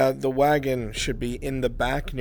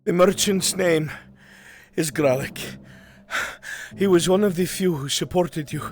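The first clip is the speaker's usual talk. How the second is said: higher, hurried report with gasps between phrases; winded messenger; 4 semitones higher than usual. Acted. Runner telling the lord about a merchant, breathless. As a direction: keep panting as you explain.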